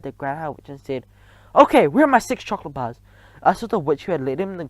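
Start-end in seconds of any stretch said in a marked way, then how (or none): 1.5-3.0 put-on pushy, raised boy's voice; demanding boy. A narrator's reading. Bruno demanding chocolate from the witch, pushy. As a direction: demand your reward.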